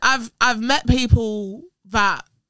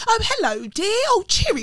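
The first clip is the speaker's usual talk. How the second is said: high, sing-song imitation of a posh British accent; mock posh English accent; 8 semitones higher than usual. Mocking how Americans picture Londoners, in a posh English accent. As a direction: put on a twee upper-class English accent, mocking. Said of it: "everyone talks like this and drinks tea".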